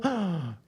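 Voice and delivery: sharp theatrical intake of breath; mock gasp. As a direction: gasp as if scandalised.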